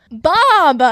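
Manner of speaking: loud, high, drawn-out cry of the name; big adoring shout; excited name cry. Real, not acted. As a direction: holler it like a delighted fan. Calls out beloved character's name.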